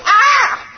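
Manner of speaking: sharp high yelp of pain; loud high pained cry; pained scream. A narrator's reading. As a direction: cry out as the hurt boy. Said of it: "he began to scream".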